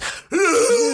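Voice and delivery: imitated scream. Jumping off the cliff.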